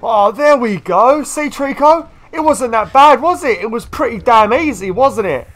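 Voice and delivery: high, sing-song talk to a pet; mock praise as to a dog; high teasing voice for a stubborn pet; sarcastic pet praise. Real, not acted.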